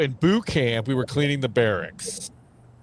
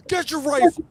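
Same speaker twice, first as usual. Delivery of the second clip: high screamed drill order; company commander's scream; 3 dB louder than usual. Retold or imitated. Company commander ordering a recruit to his rifle, high scream. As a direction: bellow it like a drill sergeant. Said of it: "scream at the top of his lungs".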